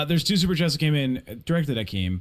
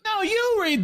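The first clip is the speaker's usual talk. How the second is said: much higher, slow and sing-song, throwing it back; mocking whine; slower than usual. Real, not acted. Tossing the super chats back to the co-host, taunting high whine. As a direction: parrot his refusal back at him in a taunting whine.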